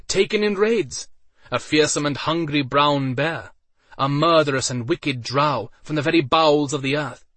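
loud, high, dramatic ringmaster; arena announcer. A narrator's reading. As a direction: present each fighter with flair. Announcer presenting the fighters.

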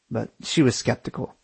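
plain reading voice

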